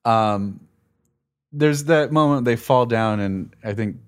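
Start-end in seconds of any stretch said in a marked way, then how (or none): none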